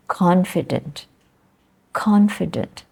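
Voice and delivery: "confident" as CON-fi-dent; stress first syllable. Shown as a demonstration. Teacher models the word for class, stress first syllable. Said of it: "where to make the stress".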